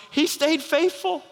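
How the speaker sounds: high, slow, marvelling cry; angels' thrilled slow cry; awed exclaim. Acted. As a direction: let the words ring with wonder.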